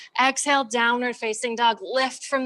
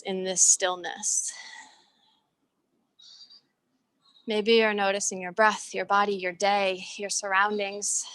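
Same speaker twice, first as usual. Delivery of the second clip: guided meditation. Acted. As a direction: ease them into stillness with long pauses. Leads a short stillness meditation.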